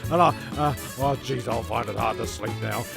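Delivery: usual speaking voice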